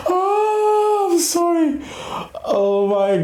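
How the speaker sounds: real laugh spilling into a high, squealed apology; breaking into laughter. Real, not acted. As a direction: crack up, then apologize in a high voice. Cracks up at own story, apologizes.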